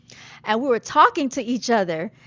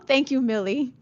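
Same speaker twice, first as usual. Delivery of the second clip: ordinary delivery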